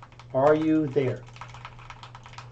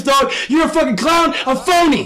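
normal talking voice

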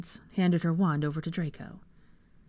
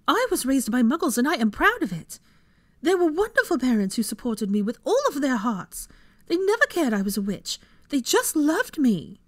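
loud, high-pitched indignant defence; angry; 8 semitones higher, 5 dB louder than usual. A narrator's reading. Hermione defending her Muggle parents, angry. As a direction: throw it back at him, proud and heated. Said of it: "turned her angry glare on him".

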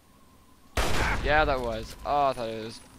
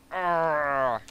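loud groan of neck pain; 4 semitones higher, 10 dB louder than usual. Real, not acted. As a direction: groan out as your neck twinges. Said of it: "ah, my neck".

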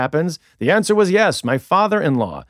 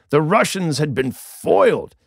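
ordinary delivery

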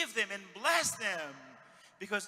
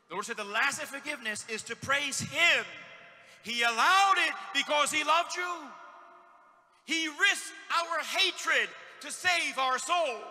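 loud and higher, hammering each word; fiery preacher driving home God's love; preaching at full force; 6 semitones higher, 9 dB louder than usual. Acted. Preacher shouts the point.

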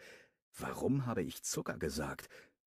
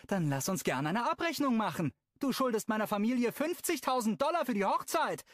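much higher, louder furious yell; screaming; 4 dB louder than usual. Acted. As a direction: scream it down the hall. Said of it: "schreit sie". (wütend geschrien)

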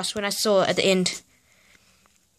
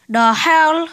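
high, loud burst of disbelief; incredulous outburst; 5 semitones higher, 4 dB louder than usual. Real, not acted. Vlogger reacting to absurd slang in a book, loudly incredulous. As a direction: blurt it out, baffled and exasperated.

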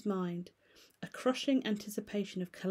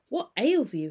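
higher, louder concerned ask; concerned question; 5 semitones higher, 7 dB louder than usual. Acted. Lawyer noticing the butler's state, raised concern. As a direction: ask with sudden worry. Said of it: "he added".

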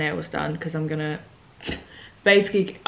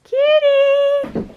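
excited; 9 dB louder than usual. Real, not acted. Admiring friend's dress.

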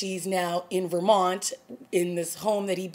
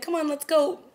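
higher, casual captor's order; mock captor order; 9 semitones higher than usual. Captor tells Olivia to come along, high and casual. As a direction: toss it off like the smug captor. Captor leads her back.

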